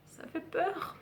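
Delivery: "ça fait peur" whispered through a laugh, high and slow; giggling dread at the knitting pattern. Real, not acted. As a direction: laugh it out in a breathy gasp.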